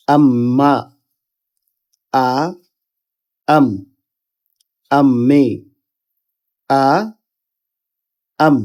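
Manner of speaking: doubled consonant held, as in আম্মা with a long m; tashdid drill. Shown as a demonstration. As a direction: build লা, লাম, লাম্মা in separate, paused steps. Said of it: "তাহলে আমাদের উচ্চারণটা হবে". (লা, লাম, লাম্মা: ভেঙে ভেঙে তাসদীদের উচ্চারণ)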